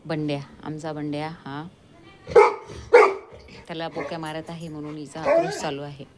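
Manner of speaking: ordinary talk, nothing marked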